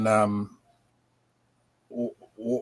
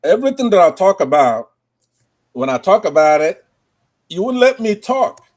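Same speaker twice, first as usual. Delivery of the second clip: louder and higher, like a fired-up orator; loud, rising declamation; declaiming; 7 semitones higher, 6 dB louder than usual. Acted. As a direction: proclaim it to the host. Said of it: "I'm gonna just do a little rhyme for you on this".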